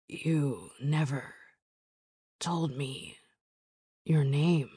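slow, halting, dry rasp; rough, scratchy voice. A narrator's reading. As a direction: speak haltingly, as if talking is hard. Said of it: "rough voice that's dry and scratchy".

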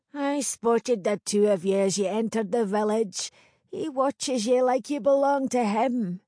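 put-on knowing old village crone. Crone character voice.

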